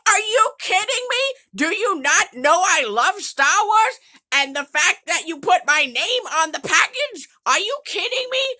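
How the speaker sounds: put-on high, loud outraged protest as a wronged kid; outraged child. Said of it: "I'm like".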